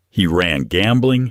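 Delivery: ordinary delivery, nothing marked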